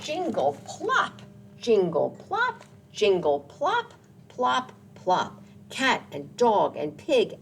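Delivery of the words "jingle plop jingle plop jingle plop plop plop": landing sound chant. Acted. Animals landing in snow.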